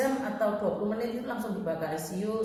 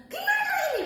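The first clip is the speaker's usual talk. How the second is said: very high, louder frantic cry; retold panicked shout; 5 dB louder, faster than usual. Mother beside baby who stopped breathing, retold panicked shout. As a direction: re-enact a desperate yell for help. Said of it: "Saya teriak".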